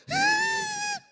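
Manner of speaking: loud, very high held shriek; high performed squeal. Acted. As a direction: let out a comic piercing squeal. Celebrating after three tackles.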